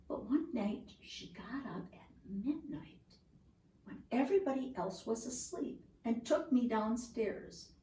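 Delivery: soft, hushed storytelling whisper. A narrator's reading. Tree telling of the sneaky midnight trip, whispered. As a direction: whisper it so nobody in the house wakes.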